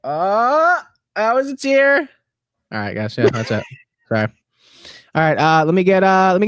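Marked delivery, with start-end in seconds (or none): 0.0-2.0 high, put-on tearful whimper; mock weepy voice; fake crying. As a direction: squeeze out a pretend tear. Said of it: "I think I got it".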